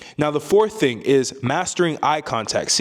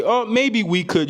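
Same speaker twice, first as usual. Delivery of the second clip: mock-doubtful, much higher and slower; mock unsure; 9 semitones higher, slower than usual. Shown as a demonstration. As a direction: play it unsure, pitch floating way up. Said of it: "Or if you wanna say".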